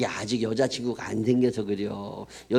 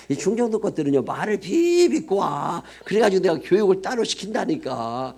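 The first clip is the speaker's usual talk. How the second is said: scornful mockery; 7 semitones higher than usual. Mocking Chungcheong people, pitched up.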